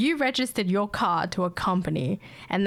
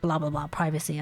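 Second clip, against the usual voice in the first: rushed caller; faster than usual. Retold or imitated. Caller rushing through complaint.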